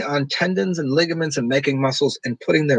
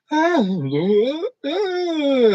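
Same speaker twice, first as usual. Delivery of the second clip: high babbling ah sounds, much higher; child noises; 13 semitones higher than usual. Retold or imitated. Showing how children make weird high noises. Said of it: "So children just go".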